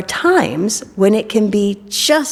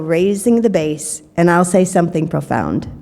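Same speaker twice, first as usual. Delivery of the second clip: ordinary talk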